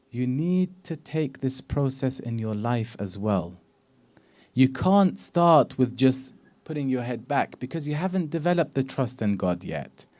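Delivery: ordinary talk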